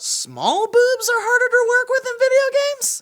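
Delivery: high, loud disbelief; incredulous. Real, not acted. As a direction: react in shocked surprise. Shocked disbelief at the quote.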